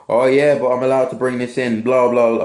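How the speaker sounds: brushing off security with a mock whine; mock punter. Security guard mimics an entitled punter, mock whiny. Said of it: "bit of role play there".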